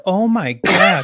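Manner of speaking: sinking realization, stretched slow; mock panic at having to follow her; dismayed oh my gosh. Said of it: "And I'm like".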